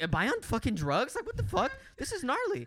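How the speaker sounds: high, bewildered rising cry; startled by a crawling room; bewildered drunk. Retold or imitated. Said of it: "And I was like".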